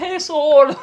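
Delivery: shout. Retold or imitated. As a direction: yell it across the cinema. Shouting at screening.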